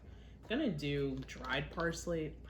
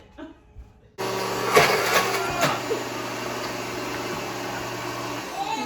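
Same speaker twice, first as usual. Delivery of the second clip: high, loud laugh through onion tears; teary laugh; 12 dB louder than usual. Real, not acted. Eyes burning from onion.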